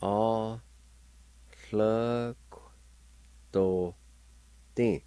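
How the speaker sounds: A, hlö kto, tin sounded out slowly with a lateral hl; teacher demo of A hlö kto tin; Cherokee syllables. Shown as a demonstration. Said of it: "like this".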